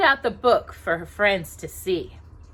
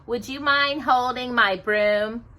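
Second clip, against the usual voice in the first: mocking; 5 semitones higher, 4 dB louder, slower than usual. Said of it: "Anastasia said mockingly".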